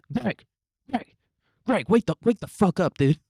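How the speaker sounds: high, insistent mock yell; yelling to wake someone. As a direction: jolt a sleeping coworker awake. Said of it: "They're, like, yelling at Greg".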